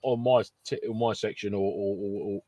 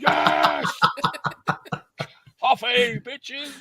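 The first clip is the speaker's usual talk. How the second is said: hyped-up, raised-pitch comic yell; manic mock battle cry; 13 semitones higher, much slower than usual. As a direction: whoop it like you're buzzing on coffee. Acts out running wild on coffee.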